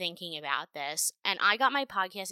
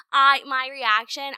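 excited outburst; 7 semitones higher, 11 dB louder, slower than usual. Real, not acted. Shocked outburst retelling reaction.

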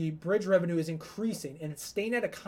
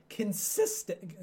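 voice crack; 2 semitones higher, much slower than usual. Real, not acted. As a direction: voice cracking mid-phrase. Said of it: "Sorry, my voice cracked there".

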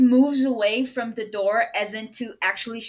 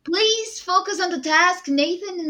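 high, strained acted plea; exasperated urging; 10 semitones higher, 3 dB louder than usual. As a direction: urge them back to the task. Injured Trent urges Betty on.

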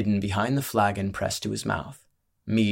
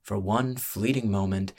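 usual speaking voice

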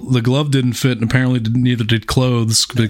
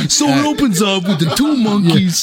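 high-pitched mock recap; mocking imitation; 10 semitones higher than usual. Host mimics co-host's monkey recap, mocking and high. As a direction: parrot it back in a silly high voice.

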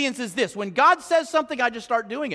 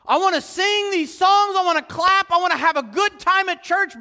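high, loud mocking churchgoer wanting fun; mock the self-centred worshipper, raised high; selfish churchgoer; 7 semitones higher, 3 dB louder than usual. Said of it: "voicing the selfish churchgoer".

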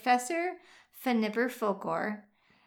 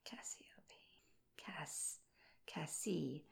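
whispered to herself; 4 semitones lower, 18 dB quieter, slower than usual. Real, not acted. Trying name to herself.

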